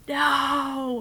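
high, drawn-out "no"; mock-agonized wail of defeat; mock dismay. Floor creaks as she escapes.

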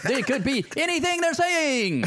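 high, hammed-up future villain; improvised computery conqueror with erratic emphasis; Kang the Conqueror. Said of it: "What do you think Kang the Conqueror sounds like?".

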